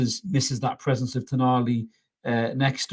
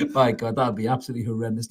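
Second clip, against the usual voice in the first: usual speaking voice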